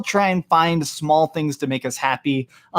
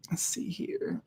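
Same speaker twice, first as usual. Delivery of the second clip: whisper; 2 semitones higher, 16 dB quieter, slower than usual. Real, not acted. Muttering while reading chat.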